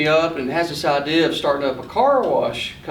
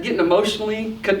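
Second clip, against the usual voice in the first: normal talking voice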